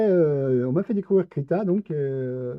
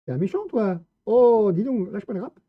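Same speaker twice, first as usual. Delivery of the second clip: higher, louder mock telling-off; mock scolding; 8 semitones higher, 7 dB louder than usual. Gamer mock-scolding a monster, higher and louder. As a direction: scold the monster like a naughty pet.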